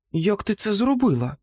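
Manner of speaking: high, amazed question; can't believe what just happened; stunned. A narrator's reading. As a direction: Jack stunned, asking the Christmas pig. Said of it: "приголомшено запитав".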